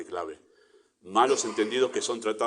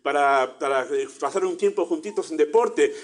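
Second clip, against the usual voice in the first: higher, louder, put-on chummy sports invite; mock friendly invite; 6 semitones higher, 6 dB louder than usual. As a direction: bait them with a cheery football invite. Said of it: "Nunca le dijimos". (invitación fingida amistosa, más aguda y fuerte)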